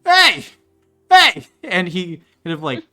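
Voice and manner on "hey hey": loud, high calls of hey; calling out. Acted. Father calling his wife, loud and high. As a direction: call into the house.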